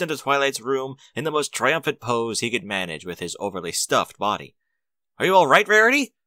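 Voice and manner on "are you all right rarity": loud, higher, breathless call; out of breath from running up the stairs; gasped. A narrator's reading. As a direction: burst in gasping the question. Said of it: "he gasped".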